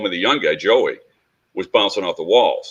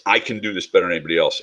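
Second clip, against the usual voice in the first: usual speaking voice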